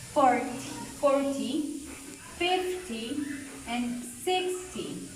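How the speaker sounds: forty, fifty, sixty stressed on the first syllable; careful teacher's forty, fifty, sixty, weight up front. Shown as a demonstration. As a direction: lean on "for", "fif", "six" in forty, fifty, sixty. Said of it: "stress is gonna be in the first syllable".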